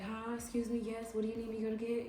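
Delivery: shy timid self. Retold or imitated. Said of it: "I was so shy and so quiet".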